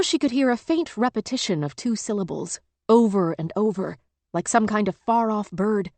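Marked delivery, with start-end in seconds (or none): none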